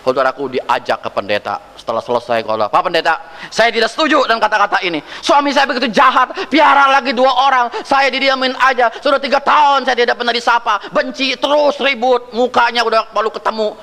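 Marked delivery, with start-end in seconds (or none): 2.7-13.7 fast, high, indignant complaint to the pastor; a wife pouring out her grievance, pitched up; angry wife. Retold or imitated. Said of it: "Ini ibu udah marah". (istri marah mengadu ke pendeta, cepat dan tinggi)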